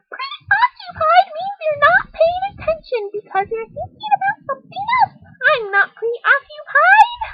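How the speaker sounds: put-on squeaky high eager kid's yes; high girl voice. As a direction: shout it up high like an excited little girl. Helen defends herself indignantly.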